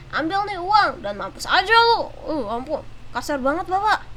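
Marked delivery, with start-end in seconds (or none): 0.1-2.1 mock angry driver snapping back; hammed-up rude driver; angry customer. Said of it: "Dia marah".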